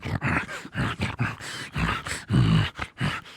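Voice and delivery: low, rasping repeated growls; animal snarling. Acted. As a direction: snarl in bursts while clawing away. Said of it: "he's climbing into this carpet going".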